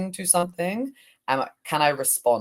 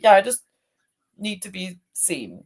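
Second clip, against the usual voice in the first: higher, then fading to a small voice; the hurt part telling her self it needs to be seen; wounded inner part; 4 semitones higher than usual. Acted. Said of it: "I got really into character".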